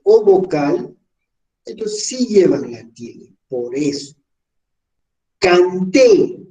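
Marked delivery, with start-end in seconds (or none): 5.4-6.4 canté with a loud, held TÉ; canté, louder on the final syllable; stress on last syllable. Shown as a demonstration. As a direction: punch the end of canté. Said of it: "La fuerza de entonación en la sílaba".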